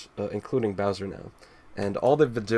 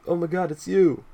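higher, louder, starstruck; 8 semitones higher, 4 dB louder than usual. Retold or imitated. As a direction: gasp it like meeting a hero. Starstruck troll voiced.

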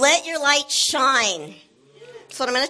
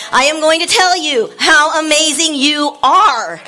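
loud, high preaching to the crowd; full-force preaching; 4 semitones higher, 10 dB louder than usual. Acted. Pastor rallying the church, loud and fired up. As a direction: preach it out big and bold.

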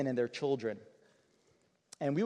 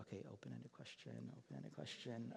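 muttered under the breath, much softer and lower; quiet reminder to himself; 3 semitones lower, 21 dB quieter than usual. Retold or imitated. Young pastor reminding himself under his breath, hushed murmur. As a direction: coach yourself in a hushed murmur.

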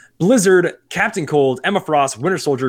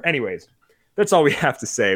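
usual speaking voice

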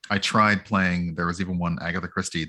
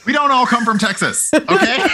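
high, loud protest through laughter; pitched way up, laughing and indignant; 7 dB louder than usual. Real, not acted. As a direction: protest loudly while cracking up. Laughing protest at accent impression.

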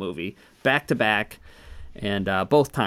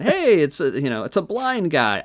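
high, louder imitation of an excited character; mock gleeful call; 5 semitones higher, 3 dB louder than usual. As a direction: sing out the discovery in playful imitation. Same movie line, acted gleefully.

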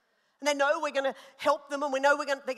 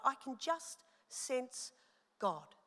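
softer, slow hushed wonder; hushed awe; 8 dB quieter, much slower than usual. Retold or imitated. Pastor alone in her wardrobe sensing God, slow soft awe. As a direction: let it drop to a reverent hush. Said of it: "I'm like".

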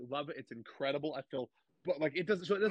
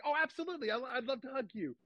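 high, gushing acceptance; delighted reply; 10 semitones higher than usual. Retold or imitated. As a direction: answer a fan with pitched-up delight. Retold delighted reply to fan.

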